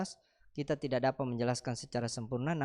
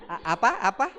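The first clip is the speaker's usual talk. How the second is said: loud feigned confusion, asking apa twice; mock not-hearing; 4 semitones higher, 8 dB louder than usual. Dodging a believer's question, loud mock "apa?". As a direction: pretend you can't hear the question. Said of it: "kau pura-pura tutup telinga".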